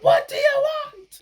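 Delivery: high, loud "What do you want?" snap; irritated snap. Retold or imitated. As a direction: bite the kid's head off, mock irritation. Snapping at child, retold.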